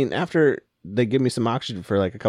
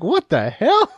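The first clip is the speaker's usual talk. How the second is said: high loud what the hell; startled, high-pitched disbelief; mock shock; 14 semitones higher, 7 dB louder, much slower than usual. Patient recalls thinking staff would kill a dog, high surprised cry. Retold shock while on nitrous.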